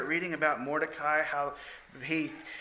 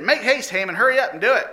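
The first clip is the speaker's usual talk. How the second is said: louder, higher royal command; commanding king; 6 semitones higher, 7 dB louder, faster than usual. Acted. King urges Haman to go at once, loud. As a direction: order him to hurry.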